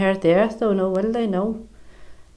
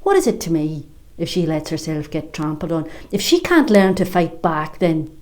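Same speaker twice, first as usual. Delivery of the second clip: usual speaking voice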